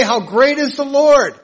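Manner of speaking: high, loud call of praise; joyful shout. Shown as a demonstration. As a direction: shout it out with joy. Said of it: "and shout it out".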